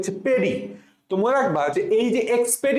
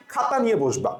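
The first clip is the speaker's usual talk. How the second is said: ordinary talk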